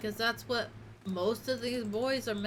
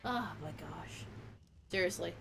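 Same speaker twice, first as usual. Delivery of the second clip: exasperated; 6 semitones lower, 7 dB quieter than usual. Real, not acted. Exasperated after pets.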